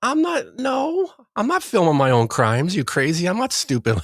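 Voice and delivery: high, incredulous protest; mock indignant. Coworker asks if he filmed it, high indignant protest. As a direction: scoff at the idea, pitch way up. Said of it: "And I was like".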